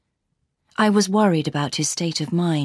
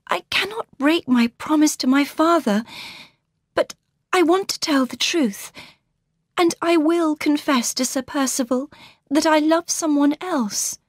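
put-on higher, gentle young woman's voice; Laura's voice; 9 semitones higher than usual. A narrator's reading. Laura greeting her sister warmly, higher voice. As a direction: lift into the young bride's voice.

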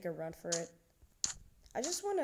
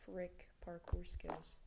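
whispering; 9 dB quieter, slower than usual. Real, not acted. Whispered aside.

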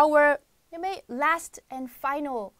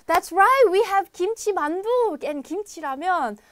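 loud, high, excited burst; overexcited host nearly shouting; too excited; 6 semitones higher, 9 dB louder than usual. Real, not acted. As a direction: blurt it out with far too much excitement. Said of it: "I got too excited".

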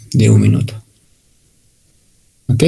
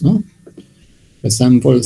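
usual speaking voice

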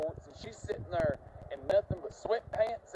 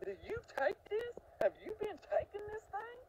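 much higher, amazed questioning; astonished; 3 dB quieter than usual. Retold or imitated. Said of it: "I was like".

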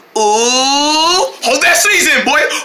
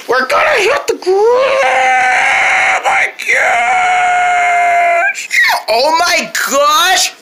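panicked scream; 12 semitones higher, much slower than usual. Acted. Screaming before impact.

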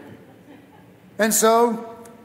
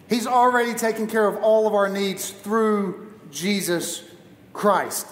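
ordinary delivery

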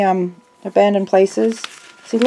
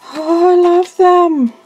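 higher, louder and slower gush; delighted; 9 semitones higher, 4 dB louder, slower than usual. Real, not acted. Gushing to viewers over quilt patterns, higher and louder.

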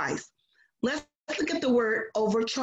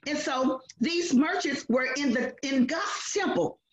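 usual speaking voice

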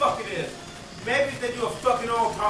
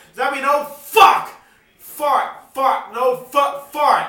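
loud, higher furious yelling; escalating rage; 4 semitones higher, 8 dB louder than usual. Real, not acted. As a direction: spit out curses one after another.

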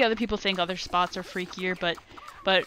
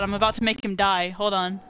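usual speaking voice

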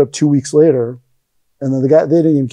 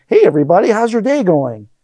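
higher, sing-out chummy hello; mock friendly greeting; 5 semitones higher than usual. What the stern sensei never said to students, mock cheery. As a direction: put on an over-friendly welcome.

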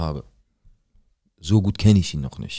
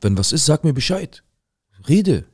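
higher and louder, pressing hard; insistent loud urging; 8 semitones higher, 5 dB louder than usual. Retold or imitated. Neighbour urging Rashid to speak up: loud insistent call. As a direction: push him to talk, voice rising.

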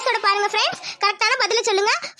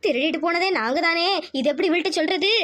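lower-pitched worried aunt; guilty aside; 5 semitones lower than usual. Acted. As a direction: say it low, as a thought to yourself. Guilty aunt's aside to herself. (தாழ்ந்த குரலில் தனக்குள் பேசும் அத்தை)

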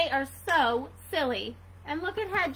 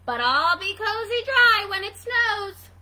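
higher, louder hedgehog comeback; defiant retort; 5 semitones higher, 10 dB louder than usual. Acted. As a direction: insist proudly over the teasing. Hedgehog defends his hat.

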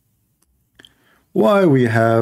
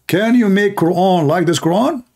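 high, mocking quote of the challenge; lightly voiced mocking challenger; mocking imitation; 8 semitones higher than usual. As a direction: mimic them mockingly in a high voice. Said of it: "they say to you".

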